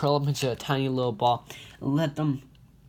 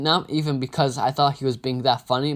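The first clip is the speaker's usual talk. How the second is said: ordinary delivery, nothing marked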